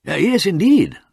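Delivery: a lightly voiced fretful groan. A narrator's reading. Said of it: "moaned Mister Murbles".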